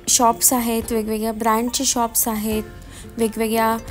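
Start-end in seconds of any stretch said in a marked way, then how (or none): none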